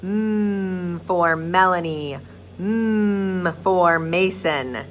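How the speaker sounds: slow "Mm for Melanie" with a long hummed mm; stretched mm sound. Shown as a demonstration. Teacher stressing the M sound, slowly, for the class. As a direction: draw out the "Mm" then say the name. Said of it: "That's the letter M".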